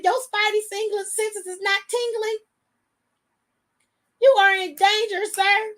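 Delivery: high-pitched, incredulous comic warning; mock exasperation. Acted exasperation at sleeping husband.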